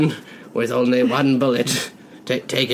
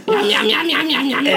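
gleeful monster munching; 10 semitones higher than usual. Acted. Lapping up blood gleefully.

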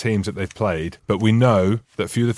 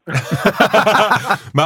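high and loud through laughter; laughing it out in disbelief; cracking up at the guest's confession; 10 semitones higher, 5 dB louder than usual. Real, not acted.

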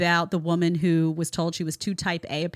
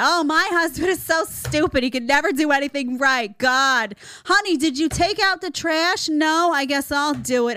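lightly voiced belittling wife; mock sneer at a useless husband; nagging wife; 6 semitones higher than usual. Wife mocking husband before guests, lightly hammed up. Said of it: "You go and it's like".

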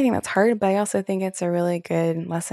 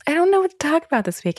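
much higher, quick and airy, fake innocent; breezy self-quote; 11 semitones higher, 3 dB louder, faster than usual. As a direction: toss it off in a high, hurried fake-casual voice. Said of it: "then I'll be like".

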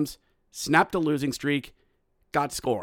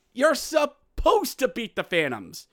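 high, emphatic comic scolding; Chris Rock; 9 semitones higher than usual. Acted. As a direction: hammer it like an exasperated stand-up comic. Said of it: "to quote the great philosopher Chris Rock".

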